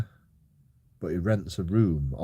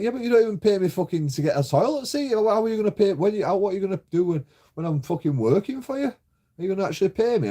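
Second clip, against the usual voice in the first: high, louder mock-outraged comeback; retold outraged reply; 10 semitones higher, 4 dB louder, faster than usual. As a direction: fire it back at him, indignant and high. Retold angry reply to landlord.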